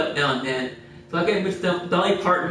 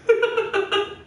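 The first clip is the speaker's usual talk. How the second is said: high laugh. Real, not acted. Laughing at his own story.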